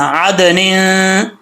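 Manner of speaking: आदनिन with throaty ain and held final n; ain, not hamza. Shown as a demonstration. Teacher warns against hamza, slow ain recitation. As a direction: open आदनिन from mid-throat, hum the ending. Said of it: "इसको हमज़ा नहीं पढ़ना".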